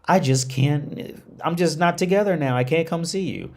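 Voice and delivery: lightly mocking a dolled-up woman brushing him off; coy distant woman. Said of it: "And she goes".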